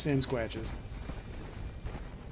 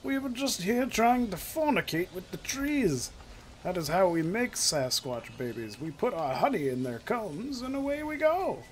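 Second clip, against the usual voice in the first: hammed-up mock British Sasquatch voice; British accent; 3 semitones higher than usual. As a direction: plead indignantly in a put-on British accent. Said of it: "it's gonna be British".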